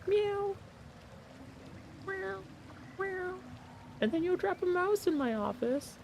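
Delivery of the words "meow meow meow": high, thin mock kitty meows; imitating cat meows. Telling her cat how she begs, in a high meow. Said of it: "And you'll go".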